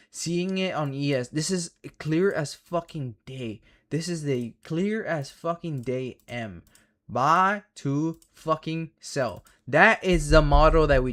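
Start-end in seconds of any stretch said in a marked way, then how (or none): 1.3-9.4 each word slowly stressed; slow emphatic. Real, not acted. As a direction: lean hard on "clear as fucking day". Emphatic slow point about chart.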